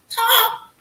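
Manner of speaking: sharp, high, loud one-word push; urging. Retold or imitated. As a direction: bark the single word out high. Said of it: "I was like".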